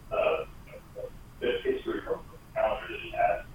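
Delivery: speakerphone across the room; faint, distant voice off mic; far from mic. Real, not acted. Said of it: "Sounds like you're on a speakerphone across the room".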